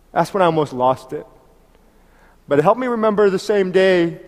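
ordinary talk, nothing marked

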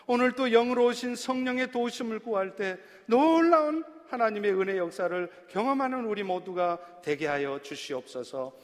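earnest pastoral prayer, rising then settling; closing prayer after the sermon. Acted. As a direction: pray over the congregation sincerely.

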